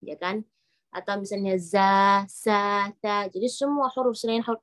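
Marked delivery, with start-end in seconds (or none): 1.6-3.3 "za, sa, ta" with thin, open a vowels; flat-tongue letters. Shown as a demonstration. Teacher gives examples of flat-tongue letters, thin vowels. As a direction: keep "za, sa, ta" light, tongue low. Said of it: "lidah kita datar".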